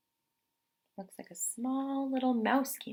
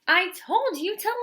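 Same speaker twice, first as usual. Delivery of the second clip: higher, louder, cross bear voice; angry bear; 11 semitones higher, 7 dB louder than usual. Acted. Bear ordering the mouse out, angry cry. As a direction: bark it at the unwanted guest. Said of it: "cried Bear".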